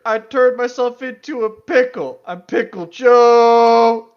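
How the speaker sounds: loud, high and over the top; hammed-up cartoon shout. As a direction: belt it out, stretching the final word. Mock cartoon catchphrase, shouted.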